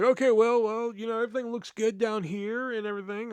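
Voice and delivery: mock cheery manager. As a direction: imitate a boss making careful small talk. Manager avoiding prying, acted out.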